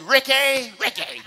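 calling out like his mama; mock motherly call. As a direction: imitate a mother calling her son. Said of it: "That's what my mama said".

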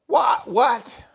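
high and loud disbelieving what; mock shocked outcry. Preacher playing a shocked listener hearing seventy years. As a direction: act out a stunned listener.

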